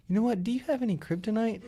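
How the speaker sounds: high, innocent-sounding question; mock-innocent customer voice; feigned innocence. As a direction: ask it lightly, pitched high.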